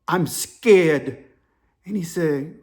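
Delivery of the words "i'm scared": put-on heavy, drawn-out African-accented words; imitated African accent. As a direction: play the scared African caller. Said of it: "The African dude's whispering".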